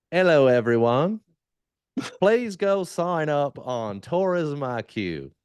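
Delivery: a hammed-up accent that can't settle between English, Southern US and Australian; mixed-up accent. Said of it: "You've gotta do it with an accent".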